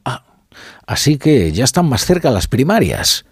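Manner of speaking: mock passive-aggressive, lifted in pitch. Radio host mimics Pablo Iglesias reacting to primaries news, passive-aggressive. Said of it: "se pone pasivo-agresivo y dice algo así como".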